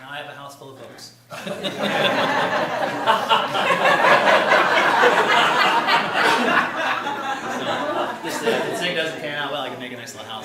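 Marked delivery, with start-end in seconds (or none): 1.2-6.8 long loud laugh; real hearty laugh. Real, not acted. House full of books joke. 6.8-10.4 talking through laughter, louder; amused self-mockery; laughing. Real, not acted.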